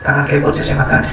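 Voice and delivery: low, humble apology to a god; acted low-voiced pleading for pardon; mock apology. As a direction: apologise sheepishly, pitched well down. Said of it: "Dia minta maaf".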